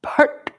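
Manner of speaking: PAT high and sharp, tongue curled back on the T; retroflex T. Shown as a demonstration. Teacher showing retroflexive ta, PAT punched high. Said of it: "it's a retroflexive ta".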